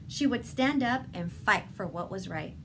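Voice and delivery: plain reading voice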